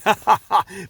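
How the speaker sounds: short chuckle; a quick laugh to yourself; amused laugh. Real, not acted. Wrestling reviewer tickled by Sammy's act, amused chuckle.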